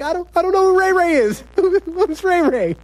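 very high, comic panic; mock-frightened high voice; panicked denial. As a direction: squeak out a bewildered protest, half laughing. Retells his panicked denial to inmates.

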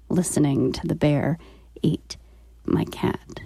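ordinary talk, nothing marked

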